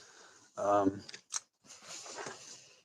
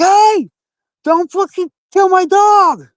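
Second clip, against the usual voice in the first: high, loud panicked scream; 6 dB louder, slower than usual. Retold or imitated. Handcuffed in ants, screaming at cops about his dog, panicked scream. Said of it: "And I'm screaming at this point".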